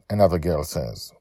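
ordinary delivery, nothing marked

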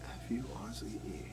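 whispered spelling, letter by letter; whispering to oneself. Real, not acted. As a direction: whisper it back to yourself. Repeats spelling to himself.

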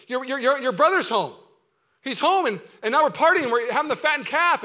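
high, loud, stammering excitement; excited servant. Acted. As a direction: blurt out the party news. Servant breaks news of party.

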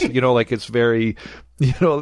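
ordinary delivery

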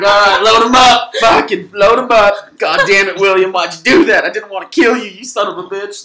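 loud, high hammed-up lament; mock distraught posse. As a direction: yell it like a grieving outlaw. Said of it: "we're like".

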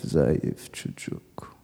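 slow, low whispered echo. Retold or imitated. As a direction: whisper it like a stinging memory. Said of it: "I can still feel the sting".

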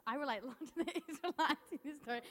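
nervous laugh. Real, not acted. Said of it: "Sorry, I'm nervous".

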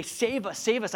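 high plea for rescue; pleading disciples. Retold or imitated. Disciples beg Jesus to save them, high mild plea. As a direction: beg to be saved. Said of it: "go to Jesus and say".

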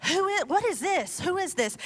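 awed bewilderment. Retold or imitated. Disciples after Jesus rescued them, bewildered. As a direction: imitate stunned disciples asking each other. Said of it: "their response is so cool 'cause they're like".

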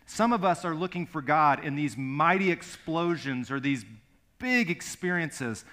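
loud, ringing sermon delivery; preaching at full force. Acted. Pastor preaching the Elijah story, loud and fervent. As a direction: drive it home to the congregation.

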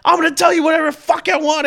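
fast, high, loud angry shout; heated yelling. Retold or imitated. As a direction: rattle it off in a fury. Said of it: "I got real heated with her".

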